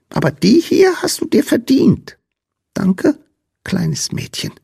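very high squeaky voice; grateful little creature. A narrator's reading. Sandman rewarding and thanking Lina, high squeaky voice.

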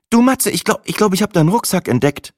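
sehr hoch und lauter, spielerisch stockend; Sina, neckend. A narrator's reading. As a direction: sie zieht Matze mit dem rosa Rucksack auf. Sina in the story. (Sina entdeckt neckend den rosa Rucksack)

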